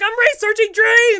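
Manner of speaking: high, loud caught-out excuse; defensive. Retold or imitated. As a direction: insist it, flustered. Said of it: "I was like".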